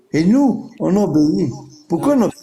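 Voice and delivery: ordinary talk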